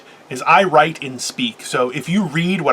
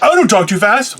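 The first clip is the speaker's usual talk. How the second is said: higher-pitched, indignant mock protest; defensive retort; 6 semitones higher, 3 dB louder than usual. Speaker mimics someone rejecting advice on talking too fast, mock-defensive. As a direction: push back as if wrongly accused. Acting out defensive reply to criticism.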